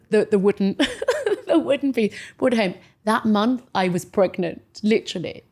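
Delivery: normal talking voice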